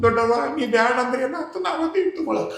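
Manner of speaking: quick, high eager voice; greedy relatives. Acted. Relatives pleading with the old aunt, quick and high. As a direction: blurt it fast and high, grabbing.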